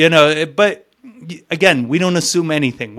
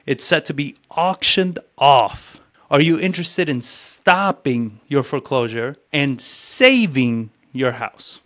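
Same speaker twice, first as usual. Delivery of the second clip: slow, with pauses before set and saving; pauses before key words; slower than usual. Shown as a demonstration. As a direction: stop just before each crucial word. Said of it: "I'm stopping in front of".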